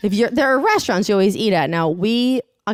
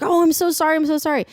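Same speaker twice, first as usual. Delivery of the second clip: high, gushing apology; mock sweet dog owner; imitated apologetic woman; 9 semitones higher, faster than usual.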